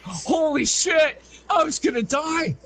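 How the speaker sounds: yelling it in remembered terror, slowly; retold shout. Telling friends how scared he was, slow shout. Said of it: "I'm just like".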